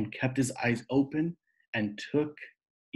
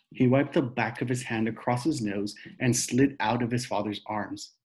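ordinary delivery, nothing marked

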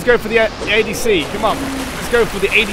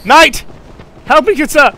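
mock knightly plea; 8 semitones higher, 8 dB louder than usual. Calling knight for help.

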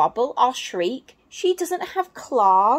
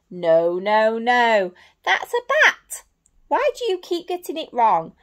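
exasperated monkey; 4 dB louder than usual. Acted.

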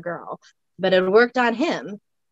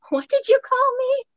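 high, outraged disbelief; indignant. Acted. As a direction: balk at the insult, pitched way up. Cassie insulted, called witch.

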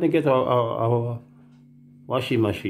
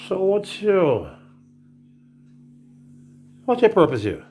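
mock immigration officer; 9 semitones higher than usual. Immigration officer questioning arrivals, lightly mocked.

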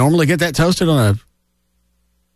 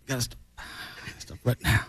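whispered imitation; 15 dB quieter than usual. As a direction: breathe it almost silently. Host imitating voicemail, whispered.

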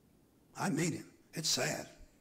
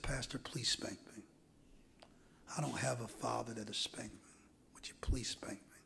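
put-on begging girl; 2 semitones lower, 8 dB quieter than usual. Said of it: "Begged him".

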